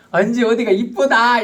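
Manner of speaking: high, loud fawning compliment; butter her up; flattering. Retold or imitated. Husband flattering his wife, high and loud. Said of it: "이러면서 막 아부를 해요".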